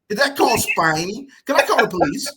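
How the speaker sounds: high, loud playful accusations; mock outrage. Guest jokingly accusing host of spying, mock outrage.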